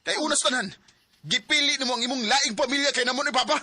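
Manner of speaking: loud, high accusation held through; angry shout. Acted. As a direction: throw the betrayal in her face. Son accuses mother of leaving.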